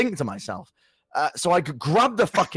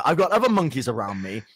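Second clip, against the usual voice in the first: usual speaking voice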